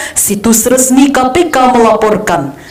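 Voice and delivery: "situs resmi K P K melaporkan" in an even tone, no emphasis; unaccented reading, falling on "melaporkan"; flat news statement. Shown as a demonstration. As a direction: keep every word of "situs resmi K P K melaporkan" level. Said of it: "nggak usah datar aja".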